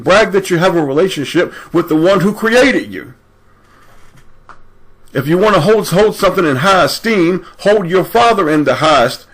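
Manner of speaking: fired-up exhortation from the pulpit; forceful preaching. Acted. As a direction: preach it hard, pitch up high. Preaches scripture at full force.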